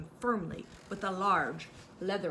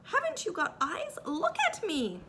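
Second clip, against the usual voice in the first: much higher and slow exasperation; indignant Piglet; 11 semitones higher, 3 dB louder than usual. A narrator's reading. Piglet exasperated with Kanga, much higher and slow. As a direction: squeak it out, fed up.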